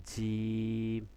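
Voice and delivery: "জিম" with a very long held vowel; long stretch. Shown as a demonstration. Teacher asking how far to stretch it, "জিম" held long. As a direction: draw out the middle of "জিম" evenly. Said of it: "জিমটা কতটুকু টান হবে".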